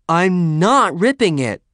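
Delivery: high, loud, drawn-out denial; irritated protest. A narrator's reading. Helen to nagging Lorraine, exasperated protest. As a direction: protest it slowly and loudly.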